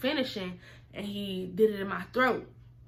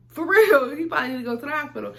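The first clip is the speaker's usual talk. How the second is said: emphatic shout; 5 semitones higher, 9 dB louder than usual. Retold or imitated. Insisting to her friend.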